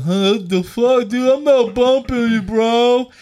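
slow, hammed-up drunken slur; slurring drunk. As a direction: play sloppy drunk, dragging the words. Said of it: "like I'm slurring".

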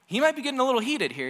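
a preacher's mock heat. Shown as a demonstration. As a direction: let the heat rise in the voice.

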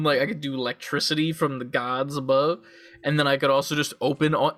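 ordinary delivery

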